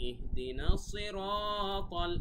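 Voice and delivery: "ইহদিনা সিরাতাল" high and slow, "রা" drawn out; elongated vowel. Shown as a demonstration. Teacher showing one alif of stretch, elongated "সিরাতাল". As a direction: hold the "রা" of "সিরাতাল" long. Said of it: "এক আলিফ টান আছে কিন্তু".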